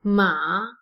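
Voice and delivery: MA low and dipping; third tone. Shown as a demonstration. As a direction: let "Ma" sink low, then lift slightly. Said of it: "is the third tone".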